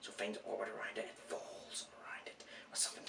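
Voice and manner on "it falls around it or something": whispering. Real, not acted. Trailing off in whisper.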